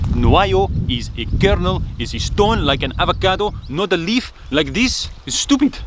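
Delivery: put-on high, exasperated French accent; Armand's French style. French friend explaining the kernel, in an exasperated French accent. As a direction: explain it like an indignant Frenchman, rising to a squeak. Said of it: "in his characteristic French style".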